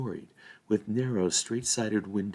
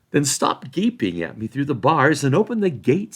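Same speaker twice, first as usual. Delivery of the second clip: impatient Merry; 6 semitones higher, 7 dB louder than usual. A narrator's reading.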